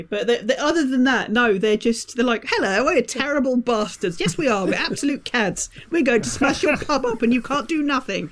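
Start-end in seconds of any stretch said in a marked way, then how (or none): 2.5-8.3 posh toffs. Retold or imitated. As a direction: send up a swaggering upper-class cad. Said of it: "those three posh, annoying toffs".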